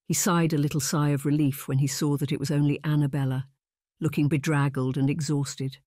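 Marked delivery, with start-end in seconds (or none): none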